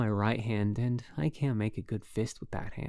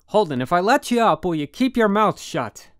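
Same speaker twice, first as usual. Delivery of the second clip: loud, much higher, furious shouting; furious demand; 8 semitones higher, 11 dB louder than usual. A narrator's reading. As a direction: demand it at top volume. Roommate demanding silence, furious.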